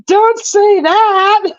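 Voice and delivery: pleading with the teasing friend, high, loud and slow; spooked plea. Retold or imitated. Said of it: "I'm like".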